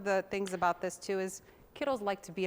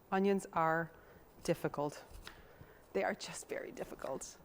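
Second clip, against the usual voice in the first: normal talking voice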